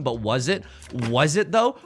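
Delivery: ordinary delivery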